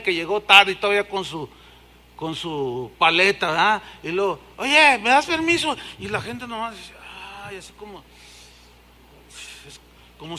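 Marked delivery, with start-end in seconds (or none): 4.6-5.7 higher casual mock request; pushy latecomer. Latecomer with a lollipop pushing past, higher voice. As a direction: squeeze past carelessly.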